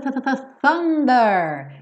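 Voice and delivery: th-th-thunder practiced; th sound drilled. Shown as a demonstration. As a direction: repeat the th of thunder, tongue between teeth. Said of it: "agora sim".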